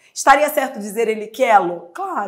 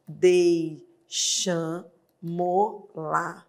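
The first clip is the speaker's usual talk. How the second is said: "deixamo-la" slow and soft; slow answer; 4 semitones lower, 6 dB quieter, much slower than usual. Shown as a demonstration. Teacher reveals the final form, slow and quiet. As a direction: ease out "deixamo-la" slowly.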